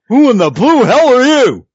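high, loud wrestling promo bellow; The Rock. Retold or imitated. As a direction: imitate an indignant wrestler shouting his catchphrase. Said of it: "Right in the middle of Rock's promo".

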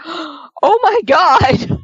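very slow, drawn-out mock shock; gasping shock. Wife hearing about the porn store date, drawn-out shock. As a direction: stretch it out in horror.

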